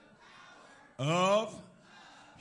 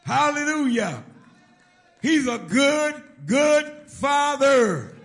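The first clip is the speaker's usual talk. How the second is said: much louder and higher, stretched slow; ecstatic preaching climax; 10 semitones higher, 19 dB louder, slower than usual. Acted. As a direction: cry it out to the rafters over the music. Climax of the praise section.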